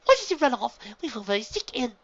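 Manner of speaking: high, loud scolding filly. A narrator's reading. Big sister scolding the runaway filly, high and loud. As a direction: tell her off sharply. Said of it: "shifting to scold the little filly".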